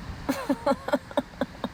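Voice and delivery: soft breathy chuckle; laugh. Real, not acted. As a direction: let out a soft, rapid chuckle.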